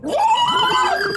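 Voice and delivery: very high loud squeal; creature squeal. Acted. Jeremy squealing as he attacks. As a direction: let out a shrill beast cry.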